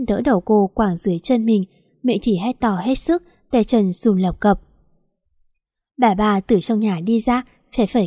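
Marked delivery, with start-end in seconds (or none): none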